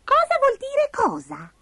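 very high, slow query; distracted squeaky creature; falsetto, drawn-out puzzlement; Abigail the little spider. A narrator's reading.

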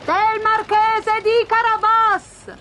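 high, slow chanted answer; reapers in chorus. A narrator's reading. Reapers answer the king together, high drawn-out chorus. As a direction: call it out like a chorus of field hands. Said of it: "risposero in coro i mietitori".

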